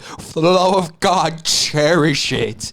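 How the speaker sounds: drawn-out, hammed-up movie plea; mock pleading. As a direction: stretch each phrase like a melodramatic appeal. Acted movie quote, pleading to cherish.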